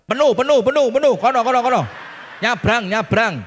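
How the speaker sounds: loud, high, rapid-fire waving away; shouting parking attendant. Retold or imitated. Parking attendant turning a driver away, shouting. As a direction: call out insistently over the traffic.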